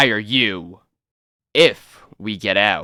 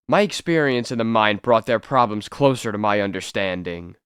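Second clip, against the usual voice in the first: usual speaking voice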